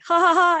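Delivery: loud, high mock laugh; retold laughter. Telling how she laughed in the cab at the nightmare, mock laugh. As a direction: burst of put-on ha-ha-ha.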